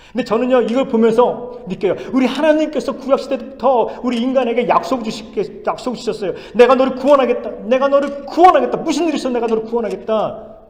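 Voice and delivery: loud, high-pitched sermon declaration; impassioned preaching. Acted. Preacher proclaiming God's promise to the congregation, impassioned. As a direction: preach the promise with full conviction.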